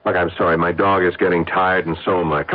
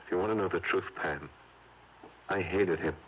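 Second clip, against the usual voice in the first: quiet confession; 10 dB quieter than usual. Acted. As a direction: let it out softly, almost to himself.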